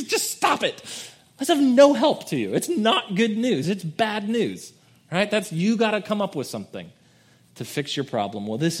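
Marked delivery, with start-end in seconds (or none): 0.0-4.7 much higher, louder mock rant, laughing; hammed-up angry counselor; mock angry outburst. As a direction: scold them like a fed-up counselor. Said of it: "He just gets angry and says".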